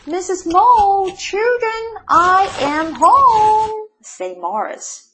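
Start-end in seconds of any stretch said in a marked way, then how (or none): 0.1-3.9 loud, high homecoming call with long-held words. A narrator's reading. Mole announcing himself to wife and children, calling out. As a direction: sing it out to the whole house. Mole calls to family on arrival.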